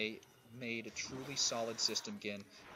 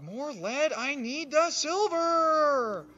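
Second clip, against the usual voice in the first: high, slow, drawn-out shout; excited yell; 13 semitones higher, 12 dB louder, much slower than usual. Real, not acted. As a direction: belt it out with excitement.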